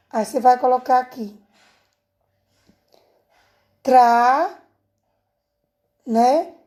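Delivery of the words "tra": TRA alone, louder and drawn out; isolated syllable. Shown as a demonstration. Teacher showing pupils the first syllable, TRA set apart firmly. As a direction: punch out TRA as one separate syllable. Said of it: "só uma sílaba".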